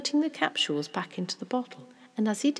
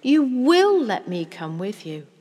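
put-on loud, insistent child's demand; urgent pleading at raised volume; 4 semitones higher, 11 dB louder than usual. A narrator's reading. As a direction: press the plea loudly, higher pitched. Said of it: "raising my voice".

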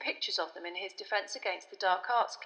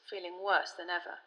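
ordinary delivery

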